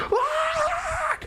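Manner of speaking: long high-pitched scream; re-enacted scream. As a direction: let out a drawn-out shriek. Reliving screaming at his sister.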